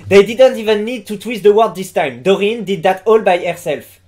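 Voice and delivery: basic French accent. Shown as a demonstration. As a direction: read English like a basic French speaker. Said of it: "je tente l'accent français de base". (accent français de base en anglais)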